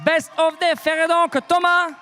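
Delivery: hyped giveaway announcement. Real, not acted. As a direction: shout the winner's name high. Revealing the day's single winner.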